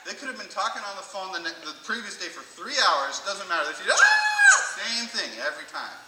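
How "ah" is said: high, loud burst of a squeal; excited greeting scream. Retold or imitated. Mom seeing her friend daily, excited scream. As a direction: squeal as if reunited. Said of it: "they're excited to see each other".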